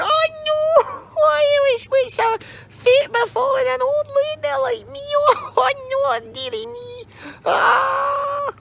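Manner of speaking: put-on very high, whimpering old crone; wailing old lady.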